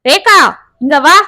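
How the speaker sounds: husband yelling for his wife after tasting the food; shouting. Acted. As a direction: bellow her name from the table. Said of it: "என்று கத்தினான்".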